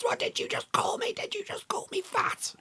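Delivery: very high, thin mock outrage; high-pitched retold indignation; imitating outraged woman. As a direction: mimic an offended stranger in a squeaky voice. Mimics the woman taking offence.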